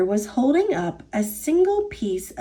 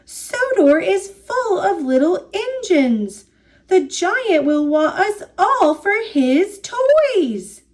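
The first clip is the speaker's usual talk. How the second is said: higher-pitched, worried warning; alarmed exclamation; 6 semitones higher than usual. A narrator's reading. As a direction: warn the others in rising alarm. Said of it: "Thomas exclaimed".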